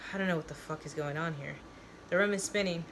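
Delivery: usual speaking voice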